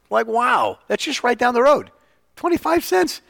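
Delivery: a bright, delighted, exaggerated wow; mock amazement. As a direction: play up the wonder at a bargain. Retold amazement at cheap pool.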